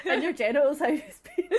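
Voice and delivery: loud laughing question. Real, not acted. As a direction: tease through laughter. Joking question while laughing.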